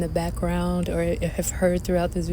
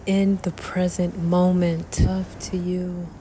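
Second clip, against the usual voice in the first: guided meditation. Acted. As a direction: guide the breath calmly. Leads a breathing meditation.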